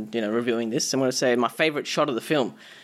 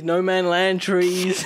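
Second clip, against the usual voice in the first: drawn-out mock solemn; 3 semitones higher, much slower than usual. Listing trees jokingly.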